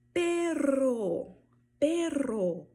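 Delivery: PERRO, high and loud with a long trill; rolled RR. Shown as a demonstration. Teacher drilling the digraph, trilled RR in perro. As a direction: roll the double R of perro hard. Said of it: "ele vai vibrar sempre".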